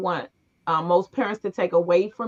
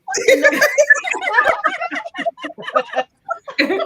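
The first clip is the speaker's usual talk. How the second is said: baffled disbelief; 5 dB louder, faster than usual. Retold or imitated. Baffled mother hears interpreted request.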